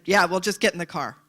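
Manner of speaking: the annoyed driver cutting her off; irritated snap. Retold or imitated. Driver snaps back in quarrel.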